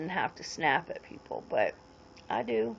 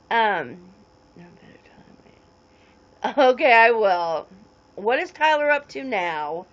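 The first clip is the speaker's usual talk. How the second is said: usual speaking voice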